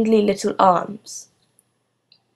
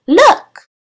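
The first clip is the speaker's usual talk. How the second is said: high, loud, eager call; excited exclamation; 13 semitones higher, 6 dB louder than usual. A narrator's reading. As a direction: grab the class's attention. Teacher showing her football sock.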